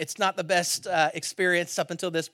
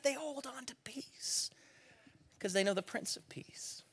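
whisper; 6 semitones higher, 12 dB quieter than usual. Real, not acted. Sermon climax, voice drops.

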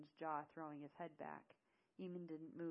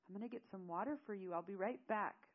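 hurried call-out; 3 semitones higher, 6 dB louder than usual. Acted. Seth calls out before running off.

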